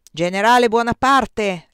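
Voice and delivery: loud, slow, drawn-out announcement; shouted reply. A narrator's reading. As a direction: call your rank and name up to the window. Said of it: "gli gridò Napoleone per risposta". (gridato in risposta, scandendo)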